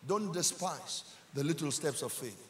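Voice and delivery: ordinary delivery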